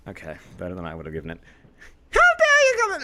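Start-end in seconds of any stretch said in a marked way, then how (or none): 2.1-3.0 high, loud mock Genji line; hammed-up Genji impression, pitched high; imitating Genji. Shown as a demonstration. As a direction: fake a dramatic ninja voice. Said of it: "Um, Genji".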